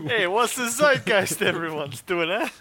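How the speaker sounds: silly put-on New York accent; bad New York accent. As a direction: ham up a New Yorker asking a question. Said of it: "a bad New York accent".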